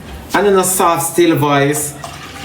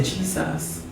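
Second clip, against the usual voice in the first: broken in spirit; 14 dB quieter, slower than usual. Acted. Believer breaking in spirit calls on Jesus, hushed. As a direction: let it out faintly, barely voiced. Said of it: "you're breaking your spirit".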